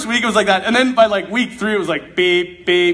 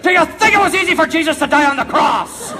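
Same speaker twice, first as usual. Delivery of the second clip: priest's rebuke; 5 semitones higher than usual. Retold or imitated. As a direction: fire the question back like a stern priest, half in jest. Priest scolding retort.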